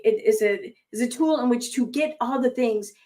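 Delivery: normal talking voice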